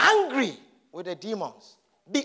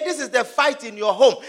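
normal talking voice

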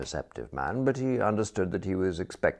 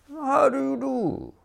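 much higher and slow, beaming cordiality; cordial greeting; 3 dB louder than usual. A narrator's reading. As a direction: greet her like a long-lost friend. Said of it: "he said, with a cordiality".

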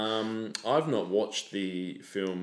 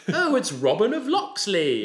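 high, slow put-on posh British voice; mock British accent; 11 semitones higher, 5 dB louder, slower than usual. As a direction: ham up a fake British hero intro. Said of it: "does attempt a British accent".

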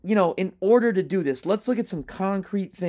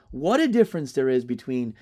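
ordinary talk

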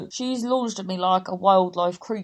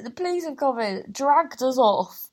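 ordinary talk, nothing marked

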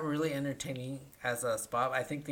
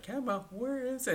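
confused Homer; 11 semitones higher than usual. Retold or imitated. Homer confused about camera.

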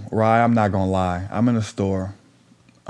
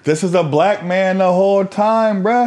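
high, loud, drawn-out disbelief; incredulous exclamation; 13 semitones higher, 6 dB louder, slower than usual. Real, not acted. Storyteller correcting a friend about who it was, loudly incredulous. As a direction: stretch it out high and loud, exasperated. Exclaiming at listener's wrong assumption.

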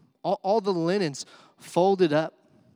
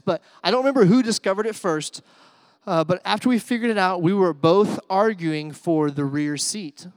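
excited storytelling; 3 semitones higher, 8 dB louder than usual. Real, not acted.

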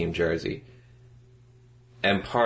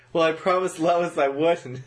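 Superman voice; 9 semitones higher, slower than usual. Retold or imitated. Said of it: "He's like".

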